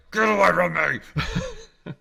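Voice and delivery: high, drawn-out mock yell; hammed-up high squawk of anger; mock angry scolding. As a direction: shoo the dog away in exaggerated outrage. Mock scolding the dog for chewing.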